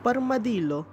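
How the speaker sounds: playful put-on Italian accent; mock Italian accent. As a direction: say the name with a hammed-up Italian accent. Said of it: "You have to put an accent on it".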